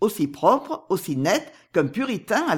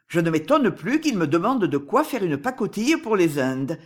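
ordinary delivery

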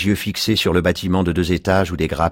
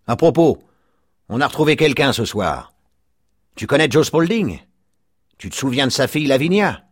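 higher, louder gossiping neighbour; chatty neighbour; 8 semitones higher, 3 dB louder than usual. A narrator's reading. Neighbour Mike teasing Lafarge about his wife, high chatty voice.

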